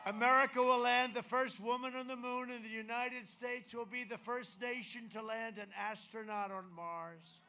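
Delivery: booming campaign rally delivery; rally speech. Acted. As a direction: proclaim it to a cheering crowd. Rally speech applause line.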